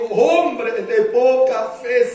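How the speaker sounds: loud, drawn-out reproach from the pulpit; thunderous rebuke. A narrator's reading. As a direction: thunder it slowly, word by word. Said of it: "una palabra estruendosa". (reprensión estruendosa y pausada)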